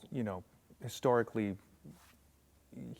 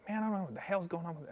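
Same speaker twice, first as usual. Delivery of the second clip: fast, higher-pitched retold grumble; mock super mad; 8 semitones higher, much faster than usual. Drummer confused by the oud things, rushed mock exasperation. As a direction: rattle off a mild mock flare-up. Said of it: "he'd get super mad".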